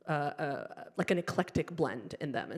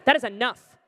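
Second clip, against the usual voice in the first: loud, emphatic put-down; acted indignant outburst; firm objection; 4 semitones higher, 8 dB louder than usual. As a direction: shut the doctor down.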